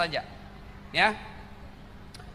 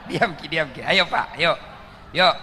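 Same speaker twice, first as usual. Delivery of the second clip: usual speaking voice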